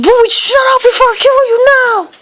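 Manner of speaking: high, loud sibling yell; annoyed big-sister shout; yelling at brother. Real, not acted. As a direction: shout him down, fed up.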